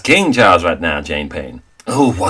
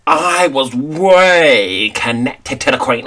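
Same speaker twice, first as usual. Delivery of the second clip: slow, drawn-out boast; higher, very slow brag; boastful; 4 semitones higher, much slower than usual. Acted. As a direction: stretch it out self-importantly. Boasting of royal connections.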